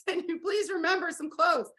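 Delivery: half-laughing, begging her to cover up; exasperated plea. Retold or imitated. Mom to daughter at the car dealership, exasperated pleading. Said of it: "I'm like".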